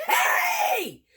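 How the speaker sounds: high, loud mock shouted greeting; retold greeting shout. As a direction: call out across the site like an old friend. Crew guy greets her loudly.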